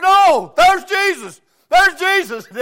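high, loud joyful shouts; excited shouting. Retold or imitated. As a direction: cry out in delight at seeing him. Preacher greets the boy as Jesus.

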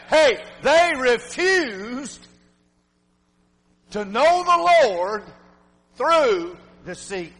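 very slow and drawn out; slow sermon refrain; slow emphatic refrain. Real, not acted. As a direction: lean on each word slowly. Preacher's refrain hammered.

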